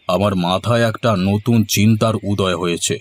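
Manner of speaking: normal talking voice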